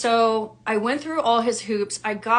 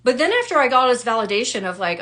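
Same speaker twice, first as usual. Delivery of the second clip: ordinary talk, nothing marked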